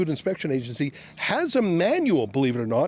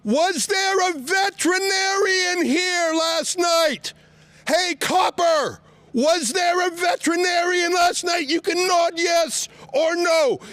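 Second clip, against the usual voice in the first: high, slow, shouted across the lot; outdoors voice; 9 semitones higher, slower than usual. Real, not acted. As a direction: shout it so the officer can't ignore you. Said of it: "I'm gonna use my outdoors voice".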